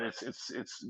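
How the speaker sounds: whispered; real whispered trail-off. Real, not acted. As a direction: let it fade to a whisper. Trailing off under breath.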